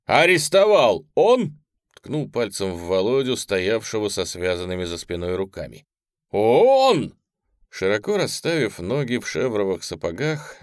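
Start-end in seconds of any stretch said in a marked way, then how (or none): 0.0-7.2 jab the question loudly; loud demanding question. Acted. Minister loudly questioning, pointing.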